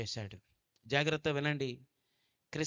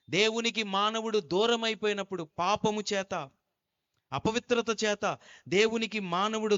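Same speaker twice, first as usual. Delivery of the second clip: raised, louder preaching voice; fervent preaching; 3 semitones higher, 4 dB louder than usual. Real, not acted. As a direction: preach it to the crowd. Preaching at full force. (బలంగా ప్రసంగం)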